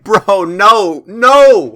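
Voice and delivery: mock outraged refusal; exasperated no. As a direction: shut him down in disbelief. Rejecting sewing talk.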